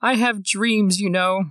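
a pitiful little pigeon pleading; pigeon's line. Retold or imitated. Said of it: "the pigeon has the one line".

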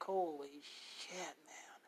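slow, hushed disbelief under the breath; a young man walking to work, whispering to himself in shock; stunned whisper. Retold or imitated. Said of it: "just thinking".

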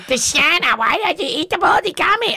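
high-pitched imitation of a kid; seven-year-old child. Seven-year-old questions Deshanna about the gummies, high mock child voice. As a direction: mimic a kid's high, scandalized question. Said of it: "And he's like".

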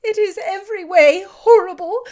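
high, loud, anguished; despairing. Acted. Elizabeth answering Darcy, high and anguished despair. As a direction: pour out hopeless anguish. Heroine in despair over sister.

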